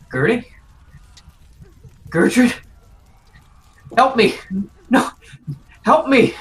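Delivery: high, very loud panicked shouts; frantic high-pitched pleading at full volume; desperate cry for help. Acted. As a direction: scream for rescue, trapped and terrified. Trapped Amy begs Gertie for help.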